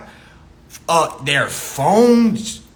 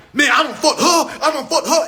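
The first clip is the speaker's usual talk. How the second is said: mock bragging; 8 semitones higher, 3 dB louder than usual. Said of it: "That's why guys over, over does it with women".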